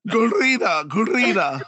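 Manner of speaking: "Grilla" stumbled over, r and l fudged; Grilla attempt. Shown as a demonstration. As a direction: fumble the rolled r in "Grilla". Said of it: "I can't do it".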